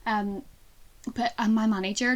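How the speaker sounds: normal talking voice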